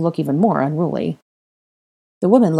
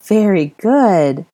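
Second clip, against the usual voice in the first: put-on louder, drawn-out praise for a child; effusive praise; 3 semitones higher, 6 dB louder, much slower than usual. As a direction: praise the little one generously. Molly praises the boy warmly.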